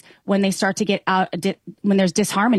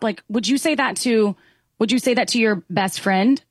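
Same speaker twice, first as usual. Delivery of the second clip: normal talking voice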